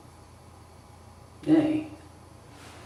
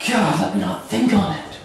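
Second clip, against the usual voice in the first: higher, pained exclamation; anguished outburst; 5 semitones higher than usual. Acted. Hamlet recoiling from his mother's remarriage, anguished outburst. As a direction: cry out to push the thought away.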